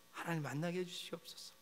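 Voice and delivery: softly, pleading to God; a quiet lament, almost breaking; hushed plea. Pretend. Preacher voicing a prayer for the land, hushed and pleading. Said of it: "탄식하기를 원합니다".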